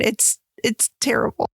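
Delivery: ordinary delivery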